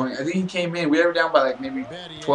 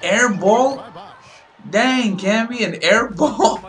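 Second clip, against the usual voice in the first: high, loud disbelief with rising questions; incredulous; 8 semitones higher, 4 dB louder, much slower than usual. Real, not acted. Gamer scolding a player for an air ball, incredulous. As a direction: exclaim as if stunned by a terrible miss.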